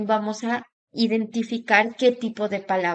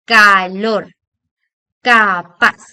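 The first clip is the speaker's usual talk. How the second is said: Calor, capaz loud and drawn out, stressing LOR and PAZ; final-syllable stress; 2 semitones lower, 9 dB louder, much slower than usual. Shown as a demonstration. Teacher giving last-syllable examples, stressed endings in Calor, capaz. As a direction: call out each word in two stretched syllables, ca-LOR, ca-PAZ. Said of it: "vamos a escuchar el acento más fuerte".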